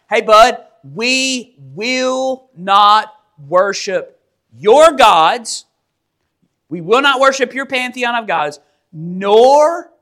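slow, emphatic refusal, word by word; flat-out defiance. Acted. Three men refusing the King of Kings outright, defiant. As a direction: lay down each word as a firm refusal. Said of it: "they flat out tell the King of Kings".

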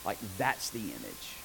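usual speaking voice

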